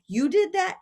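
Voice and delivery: high amazed laughing question; amused disbelief. Retold or imitated. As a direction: sound tickled and amazed.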